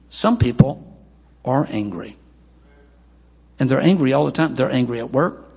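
ordinary delivery

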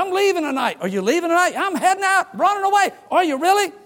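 high, hammed-up back-and-forth; mock brothers' exchange. Prodigal son announcing he leaves, high mock exchange. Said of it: "And finally he said".

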